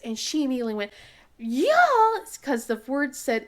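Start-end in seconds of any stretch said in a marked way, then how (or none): 1.4-2.2 eager yeah. Retold or imitated. Daughter instantly loves shirt.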